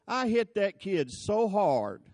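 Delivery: usual speaking voice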